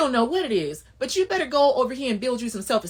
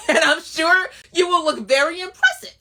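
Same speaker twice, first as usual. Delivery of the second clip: loud laughing sarcasm; cackling mockery; laughing jab; 6 semitones higher, 5 dB louder than usual. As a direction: laugh through the taunt.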